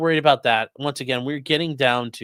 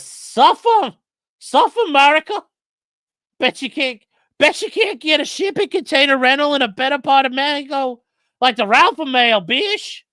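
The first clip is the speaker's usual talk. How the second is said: much higher, louder taunting voice; high, loud mocking sing-song; mocking squeaky voice; 12 semitones higher, 8 dB louder than usual. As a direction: read it as a shrill taunting troll. Reading mocking donation in a voice.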